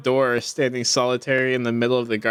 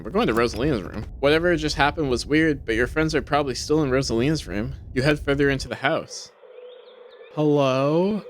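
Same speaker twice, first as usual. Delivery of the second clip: ordinary delivery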